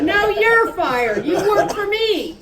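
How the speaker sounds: high, loud, slow comeback; furious shouting match; angry retort. Acted. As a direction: throw it right back at him. Acted quarrel, firing back.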